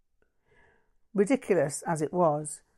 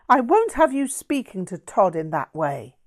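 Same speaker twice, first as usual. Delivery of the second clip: louder, indignant snap; snapped; 3 semitones higher, 7 dB louder than usual. Acted. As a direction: defend him with a sharp rebuke. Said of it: "Veranda snapped".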